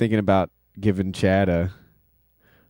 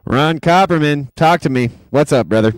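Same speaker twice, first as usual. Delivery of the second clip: hype greeting; 7 semitones higher, 8 dB louder than usual. Real, not acted. Hyping a new stream guest.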